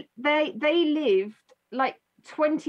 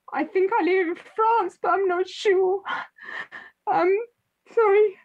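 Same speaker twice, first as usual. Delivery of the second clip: put-on posh English accent; 8 semitones higher than usual. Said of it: "like a really posh English accent".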